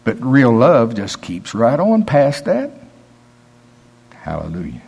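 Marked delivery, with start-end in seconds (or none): 4.1-4.8 low, soft murmured praise; soft hallelujah. Real, not acted. Preacher softly praising after speaking of his kids, low and soft. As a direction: breathe it out in quiet praise.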